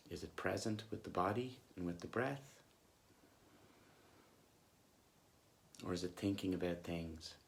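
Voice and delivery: soft, unhurried meditation voice with long pauses; guided meditation. Acted. Coach leading listeners back to body and breath, calm guided meditation. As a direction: gently guide them back to the breath, leaving long silences.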